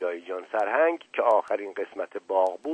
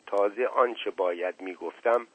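usual speaking voice

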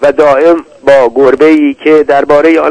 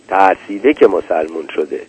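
ordinary delivery, nothing marked

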